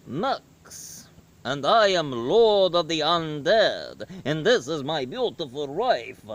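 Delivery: swooping, theatrical Nosferatu-style vampire voice; hammy vampire count, stretched and lilting; Max the vampire. Acted. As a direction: introduce yourself grandly as lord of the undead, pitch rising and falling on each word. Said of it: "who kinda sounds like this".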